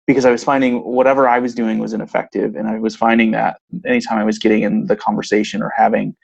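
ordinary talk

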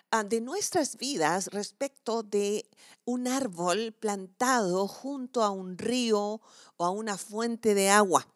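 usual speaking voice

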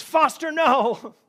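sudden high, loud warning cry; alarmed yell. Retold or imitated. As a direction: yell it urgently, out of fear for the child. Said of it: "He thought I was yelling at him".

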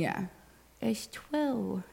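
soft, odd private voice; mom talk voice. Shown as a demonstration. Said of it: "You've never heard me talk like that?".

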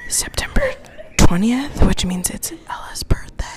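unvoiced ASMR birthday whisper; breathy close-mic ASMR shout out. Podcast host whispering a birthday shout out, ASMR style. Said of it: "S M R shout out".

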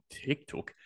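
breathy whispered TikTok, no voice; whispering. Pretend. Playful repeated platform name.

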